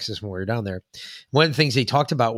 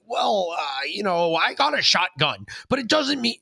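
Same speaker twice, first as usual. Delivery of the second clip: mocking politician; 7 semitones higher than usual. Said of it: "He's like".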